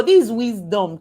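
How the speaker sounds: normal talking voice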